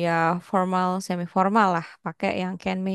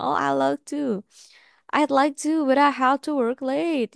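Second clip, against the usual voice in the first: voicing Dave; 5 semitones higher, slower than usual. Dave's reply in dialogue.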